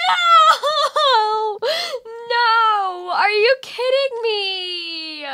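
loud high yelling. Real, not acted. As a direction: shout it in disbelief. Caught by the beast in game.

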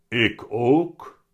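loud, weighty cat voice; heavy-voiced talking cat. A narrator's reading. The cat surprises Willem by talking, heavy voice. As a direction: speak up as a big cat with a heavy voice. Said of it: "nog een keer met zware stem".

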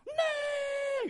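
very high, loud imitated cry; sudden shout. Preacher imitating someone crying out during his song, sudden shout. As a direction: scream out from the back of the hall. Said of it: "tiba-tiba ada orang teriak".

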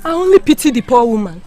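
ordinary talk, nothing marked

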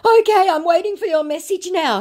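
high, pleading mock scammer. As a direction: ham up the wheedling scammer. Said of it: "he said".

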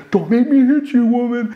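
high, strained mock threat; mock domestic bully. Mocking himself as an abusive partner, high hammed-up threat. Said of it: "like a domestic violence thing".

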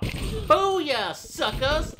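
loud, high triumphant cheer; gleeful taunt. Real, not acted. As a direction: crow it at the enemy. Gamer taunting after a hit.

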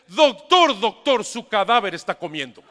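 loud, high, urgent call to the doctor; panicked nurse calling out to the doctor; alarmed nurse. Acted. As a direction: burst in shouting the news.

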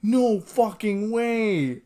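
stunned disbelief. Real, not acted. Stunned by the twist.

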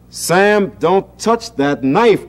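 high, loud, slow warning cry; mother's sharp warning; cried out. A narrator's reading. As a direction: call out to stop him touching the knife. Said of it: "cried his mother".

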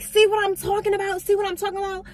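very high, loud triumphant shriek; mock shrieking boss. Boss shrieking at the stylist in front of her man, very high and loud. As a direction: shriek it like you caught her.

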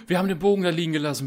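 lauter, hoher, schneller Ausruf; empörter Ausruf. Real, not acted. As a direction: den Verlust empört herausrufen. Ärgert sich über vergessenen Bogen. (empört und hastig über den liegen gelassenen Bogen)

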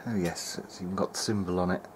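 usual speaking voice

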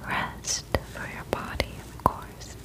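ordinary talk, nothing marked

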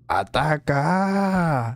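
long slow calling out; drawn-out call. Acted. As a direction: stretch it out slowly, taunting. Taunting the ghost to attack.

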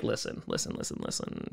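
quiet repeated whisper; whispered hush. Acted. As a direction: hush them conspiratorially.

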